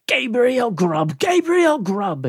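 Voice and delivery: high, drawn-out eerie chorus; wild exclaiming. A narrator's reading. Wild voices calling to the terrified sexton, exclaiming. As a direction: call the name slowly in a high put-on voice. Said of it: "exclaimed the wild voices again".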